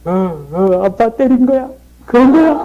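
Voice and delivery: put-on squeaky child wailing the catchphrase; son's high whiny voice, breaking into a laugh; high little boy's voice. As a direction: play a hurt seven-year-old, high and whiny. Little son cries after being hit.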